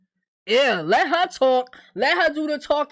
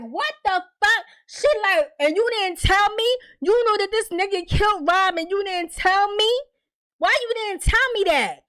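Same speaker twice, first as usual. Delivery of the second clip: higher, louder incredulous anger; imitated outrage; 8 semitones higher, 4 dB louder than usual. Tiffany yelling at Bakari for keeping the secret, higher and angry. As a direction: hit him with hurt fury.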